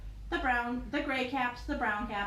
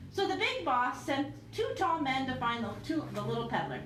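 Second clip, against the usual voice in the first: ordinary delivery, nothing marked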